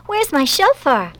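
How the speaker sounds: high-pitched alarmed question; screamed dismay. A narrator's reading. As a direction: demand it in a child's rising panic. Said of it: "screamed Barbara".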